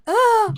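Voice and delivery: high, loud "ah!" cry; sudden high yelp to grab attention; mock stage scream. Performer on stage while backs are turned, sudden loud scream.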